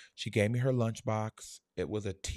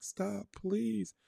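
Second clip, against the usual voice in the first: put-on high, frightened child's plea; child begging; 11 semitones higher than usual. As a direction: plead like a small boy. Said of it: "I was like".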